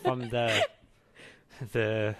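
put-on jokey German accent, Rs turned to Vs; mock German accent. Co-host trying out the R-to-V rule, laughing mock German. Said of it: "change your R's to V's".

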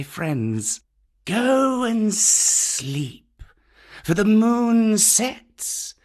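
slow, high-pitched snake voice; Kaa the python. Acted. Kaa sending the manling off to sleep, slow python voice. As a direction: stretch key words out in long glides.

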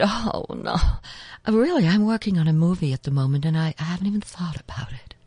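hushed star brushing off the question; Meryl Streep. Retold or imitated. Film star on the phone to her voice double, hushed impression. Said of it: "and she said".

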